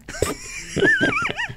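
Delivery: a high, shrieking burst of laughter. Real, not acted.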